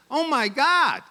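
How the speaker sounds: high, slow mock-Alabama amazement; imitated astonished American lady, thick accent; terrible American accent. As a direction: exclaim in a badly faked Alabama accent, drawn out. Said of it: "my terrible American accent".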